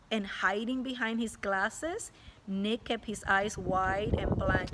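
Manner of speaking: usual speaking voice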